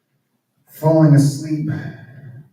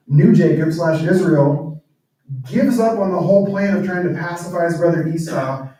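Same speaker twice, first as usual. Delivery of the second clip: usual speaking voice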